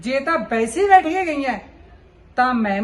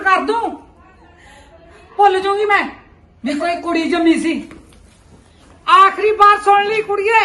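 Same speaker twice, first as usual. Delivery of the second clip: high, loud, raging yell; mother rages at her daughter at full force; furious shout; 6 semitones higher, 6 dB louder than usual. Acted. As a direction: scream the threat at the daughter. Mother's furious final warning.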